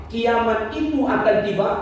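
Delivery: ordinary talk, nothing marked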